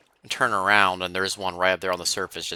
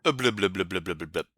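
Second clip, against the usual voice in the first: shouting underwater; faster than usual. Pretend. Swimming underwater, mock bubbling noises.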